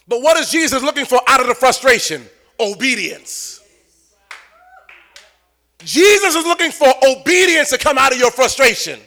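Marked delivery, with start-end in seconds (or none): none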